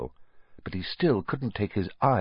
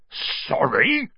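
gruff, incredulous bark rising at the end; indignant rising bark; barked disbelief; 7 semitones higher than usual. A narrator's reading. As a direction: throw the word back in disbelief. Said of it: "barked Hagrid".

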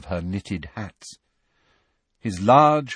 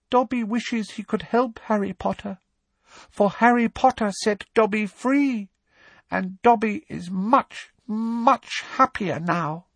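very high elf voice, earnest and grave; a devoted elf speaking from the heart; serious elf; 11 semitones higher than usual. A narrator's reading. Dobby offering Harry help, high and earnest. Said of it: "he said very seriously".

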